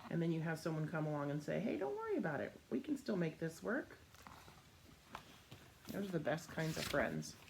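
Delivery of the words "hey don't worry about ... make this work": high, soft, quick reassurance; reassuring friend. Retold or imitated. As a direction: comfort a sad friend. Comforting friend quoted.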